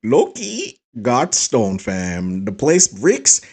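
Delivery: lifted, put-on Toronto youth slang accent; Canadian Gen Z accent. Shown as a demonstration. Viewer showing off the Canadian accent, mock Gen Z slang. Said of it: "that's the Canadian Gen Z, um, accent".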